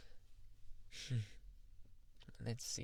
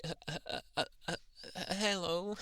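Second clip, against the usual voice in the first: nervous stammer; 7 semitones higher, faster than usual. Retold or imitated. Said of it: "I was like".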